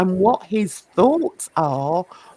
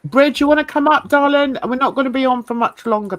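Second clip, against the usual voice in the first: calling out; 6 semitones higher, 5 dB louder, faster than usual. Real, not acted. Calling someone to come up.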